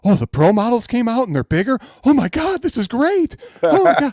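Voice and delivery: very high, loud mock squeal; gushing fanboy. Mocking fans thrilled by bigger pro models, very high and loud. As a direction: squeal like a fan who just got a toy. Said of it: "fans gushing over pro models".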